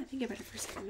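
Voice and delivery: ordinary delivery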